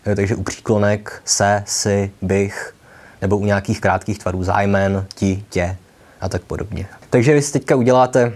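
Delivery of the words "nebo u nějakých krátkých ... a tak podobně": ordinary talk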